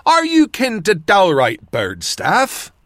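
put-on old man. A narrator's reading. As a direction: ask slowly as a curious old countryman. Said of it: "the old man said".

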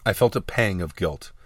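plain reading voice

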